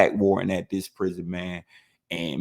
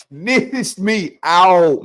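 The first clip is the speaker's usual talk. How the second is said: drawn out, louder and higher; bitter emphasis; 8 semitones higher, 7 dB louder, much slower than usual. Real, not acted. Teller names the snitch who ratted him out, slow and loud. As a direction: lean hard on every word.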